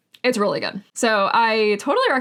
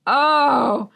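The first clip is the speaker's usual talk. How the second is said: loud, higher drawn-out "oh"; delighted surprise; 4 semitones higher, 5 dB louder than usual. Real, not acted. Reader reacting to an intriguing blurb, delighted surprise. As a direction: let out a long, raised exclamation.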